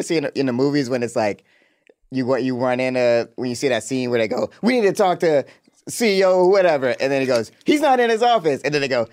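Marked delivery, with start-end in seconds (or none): 4.6-6.9 higher mock demand; acted demanding. Podcaster acting visitors demanding the boss, higher mock insistence. Acted movie confrontation at an office. 7.6-8.6 much higher put-on receptionist voice; mock movie secretary. Secretary turning visitors away from the boss, high mock protest.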